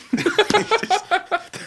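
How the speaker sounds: high burst of laughter; embarrassed laugh. Real, not acted. Podcaster laughing off a teasing remark, high burst. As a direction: laugh it off, caught out. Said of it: "That was just my immediate reaction".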